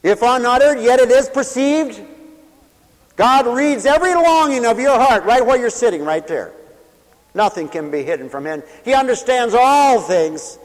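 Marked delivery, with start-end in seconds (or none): 0.0-6.5 high, loud preaching cry; full-force preaching. Acted. Preacher crying the psalm out to his radio listeners. 8.8-10.6 high, loud preaching cry; full-force preaching. Acted. Preacher crying the psalm out to his radio listeners.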